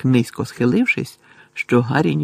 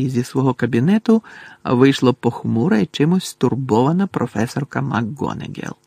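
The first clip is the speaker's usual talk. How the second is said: ordinary delivery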